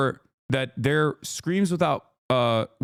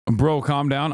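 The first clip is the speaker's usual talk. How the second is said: normal talking voice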